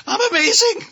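very high, loud, blurted yell; over-eager outburst. Retold or imitated. Customer finally answers barista's greeting, in an over-the-top high yell. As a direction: blast it out in a squealing, hammed-up shout. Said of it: "or how I said it".

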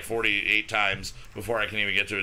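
normal talking voice

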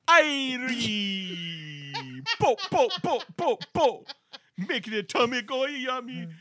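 high laughing Jamaican sing-song; Jamaican accent. Pretend. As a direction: sing it like [name], laughing. Mock Jamaican singing of jingle.

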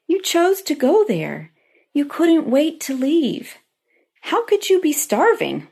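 high-pitched, incredulous inner protest; exasperated parent's silent retort, acted out; retold exasperation. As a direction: protest in disbelief, pitch leaping up. Mother's exasperated thoughts at daughter.

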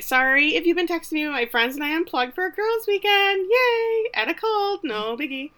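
high-pitched, over-casual voicemail act; mock awkward voicemail. Woman leaving her crush a voicemail, awkwardly upbeat. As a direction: imitate a rambling, awkwardly upbeat message.

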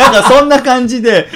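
high, loud laugh spilling into words; loud laughter. Real, not acted. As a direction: laugh through the line. Laughing after his own skit. (大笑いしながら話す)

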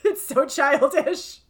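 laughing high and loud, slow; can barely get words out for giggling; giggling at a fart joke; real laughter. Real, not acted. Giggling at her own joke.

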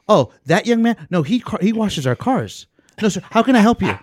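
high, loud chirpy employee voice; surprised employee. Retold or imitated. Employee brushing off the car washer to the customer, high and chirpy. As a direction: play the clueless cheerful staffer. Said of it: "And he goes".

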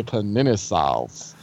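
low, slow, soft, lips kept still; talking with mouth closed. Shown as a demonstration. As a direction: jaw pulled back, mouth closed. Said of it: "you say it without moving your lips".

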